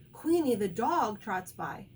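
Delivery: usual speaking voice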